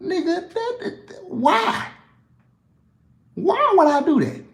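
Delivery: why would I, squeaky incredulous. Real, not acted. Answering fans who say he forces country, disbelieving and high.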